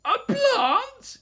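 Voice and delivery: high, loud shriek of disbelief; horrified yell. A narrator's reading. Mum to her plant-eating baby, horrified yell. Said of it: "yelled Mum in horror".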